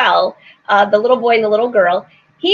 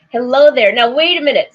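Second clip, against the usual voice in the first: ordinary talk, nothing marked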